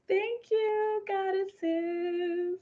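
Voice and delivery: high, drawn-out singsong; long, high held notes of gratitude; singsong thanks. Real, not acted. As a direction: call out thanks in a high lilt. Calling thanks out to goddesses.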